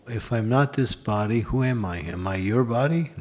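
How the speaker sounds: ordinary talk, nothing marked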